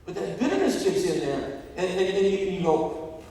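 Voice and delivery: loud, high, driving sermon delivery; preaching. Real, not acted. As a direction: preach it at full force. Preaches at full force.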